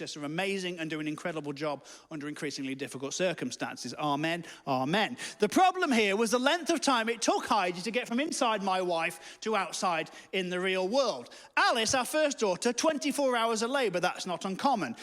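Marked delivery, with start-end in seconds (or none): none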